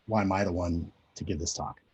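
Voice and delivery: ordinary talk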